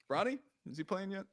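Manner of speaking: ordinary talk, nothing marked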